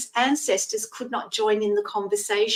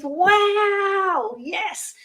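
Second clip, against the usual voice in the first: excited; 9 semitones higher, 4 dB louder than usual. Retold or imitated. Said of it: "And Anne says".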